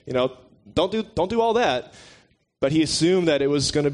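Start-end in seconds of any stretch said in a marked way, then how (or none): 0.0-1.9 higher, mock-stern "Settle down"; Paul scolding. Preacher imitating Paul hushing a rowdy church, higher mock scolding. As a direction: play Paul telling rowdy Corinthians to calm down. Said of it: "was Paul, like".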